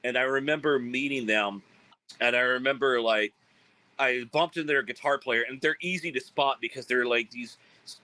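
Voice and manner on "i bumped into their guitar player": ordinary talk, nothing marked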